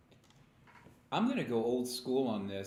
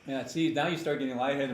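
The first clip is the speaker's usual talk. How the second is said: ordinary talk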